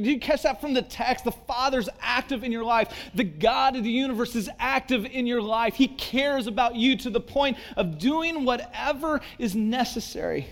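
loud, high, driving sermon peak; fiery preaching. Acted. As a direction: preach it full force to the congregation. Preaching at full force.

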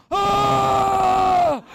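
high scream at the top of the lungs; long, loud, high panicked yell; terrified scream. Retold or imitated. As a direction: scream in panic as the truck comes at you. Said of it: "I just started screaming. Literally, top of my lungs".

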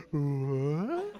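put-on soft, puzzled kid voice; mock wondering "what?", hushed; baffled kids. Wild class slowly noticing the sub, baffled kids. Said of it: "these crazy animals are like".

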